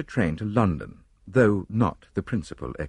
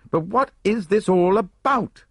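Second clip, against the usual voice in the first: high, slow, exasperated question; grumpy; 12 semitones higher, 4 dB louder than usual. A narrator's reading. Hastings wants an explanation from Poirot, high and grumpy. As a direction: demand an explanation, thoroughly put out. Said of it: "I said grumpily".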